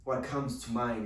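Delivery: ordinary talk, nothing marked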